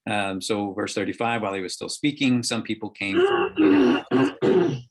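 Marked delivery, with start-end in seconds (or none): 3.2-4.8 crying voice. Acted. Messengers arrive with bad news.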